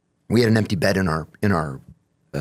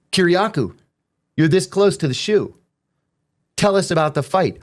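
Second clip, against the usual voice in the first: raised-pitch official warning; imitated stern warning; 6 semitones higher than usual. Lieutenant threatening inmate with the shoe, imitated warning. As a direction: warn him as the exasperated officer.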